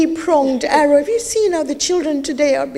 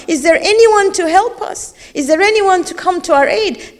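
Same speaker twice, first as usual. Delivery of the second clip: pleading call; 5 semitones higher, 3 dB louder than usual. Acted. Voicing the Imam's call for help.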